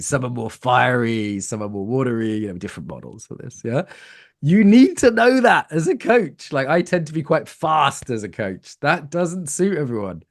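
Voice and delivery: ordinary talk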